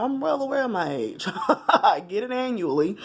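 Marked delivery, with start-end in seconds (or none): 1.7-3.0 laughing through the words; a real laugh breaking into the line. Real, not acted. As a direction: chuckle it out while joking. Joking about being asked her age.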